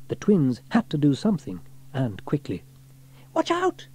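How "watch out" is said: crying out. A narrator's reading. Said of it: "cried James".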